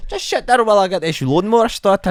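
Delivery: high, loud Scottish caricature; mock Scottish accent. Barber starting a lawnmower, mock Scottish accent. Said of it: "lawnmower is a very difficult thing to say in a Scottish accent".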